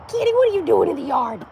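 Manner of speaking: high pet-talk voice; talking to a cat. Real, not acted. Speaker to her cat out in the yard, high pet-talk. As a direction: coo a question up high to a kitty.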